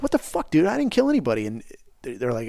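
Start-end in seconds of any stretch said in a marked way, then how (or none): 0.0-1.5 much higher, stunned protest; incredulous outrage. Retold or imitated. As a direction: squeal it out like you can't believe the judge just said that. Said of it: "And he's like".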